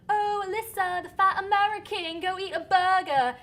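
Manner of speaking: sing-song schoolyard taunt, high and loud; mocking schoolkids. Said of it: "they'd say".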